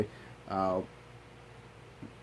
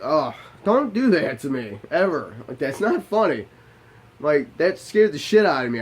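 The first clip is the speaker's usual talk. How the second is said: scolding; 5 semitones higher, 7 dB louder than usual. Retold or imitated. Husband scolds wife for the false alarm, loudly. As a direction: tell her off hard for scaring him.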